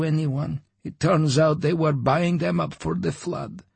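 lightly voiced old Homer; old man voice. A narrator's reading.

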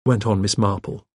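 usual speaking voice

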